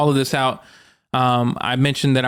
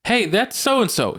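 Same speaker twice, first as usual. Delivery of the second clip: higher-pitched, slower exclamation; surprised partner; 8 semitones higher, slower than usual. Retold or imitated. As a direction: call out a surprised sighting, imitating someone. Partner spots the friend, retold.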